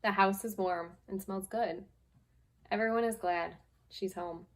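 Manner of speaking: gentle, unhurried picture-book reading; lightly voiced storytime read; reading to children. Teacher reading a picture book to her class, storytime voice.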